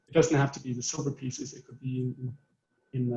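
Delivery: ordinary talk, nothing marked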